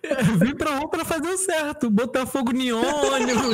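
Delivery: quoting through laughter; laughing. Retold or imitated. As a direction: laugh while mocking the line. Repeating the clip, laughing.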